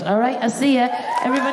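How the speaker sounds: ordinary talk, nothing marked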